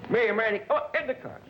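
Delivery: high-pitched hurried summons; herding everyone into the taxi; calling out. Retold or imitated. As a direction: call the family over in a high, urgent voice. Hustling family into cab.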